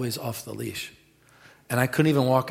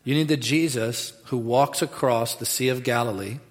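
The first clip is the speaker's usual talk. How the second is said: ordinary talk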